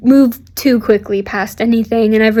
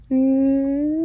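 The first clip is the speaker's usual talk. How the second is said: quick kiss; 2 semitones higher, 5 dB quieter than usual. Pretend. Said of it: "Slow motion kiss".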